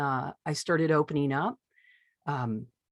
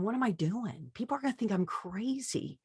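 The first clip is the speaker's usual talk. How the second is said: softer, inward fretting; hushed worry; 5 semitones higher, 7 dB quieter than usual. Retold or imitated. Recalling her fear of being judged, softer and hushed. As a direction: murmur the fear to yourself.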